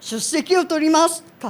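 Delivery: quoting her classroom Japanese, brisk and lively; Japanese roll-call phrase. Retold or imitated. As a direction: rattle off "सुस्सेकी ओ तोरिमासु" like a Japanese teacher. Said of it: "कहा था".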